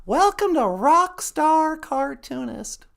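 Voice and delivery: usual speaking voice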